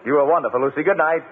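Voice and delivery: ordinary delivery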